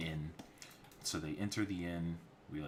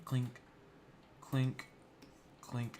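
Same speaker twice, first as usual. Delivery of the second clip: armor clink sound effect; 7 semitones higher, much slower than usual. Retold or imitated.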